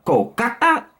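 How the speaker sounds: high-pitched, put-on Indian accent. Vlogger going through city impressions, high Indian accent. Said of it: "every time I do the Indian accent".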